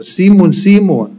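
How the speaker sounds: "Simon, Simon" said twice, each falling, both stressed; doubled emphasis. Shown as a demonstration. Preacher showing how Jesus stresses "Simon, Simon". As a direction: lean on the repeated "Simon" to mark weight. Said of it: "möchte er Betonung drauf legen".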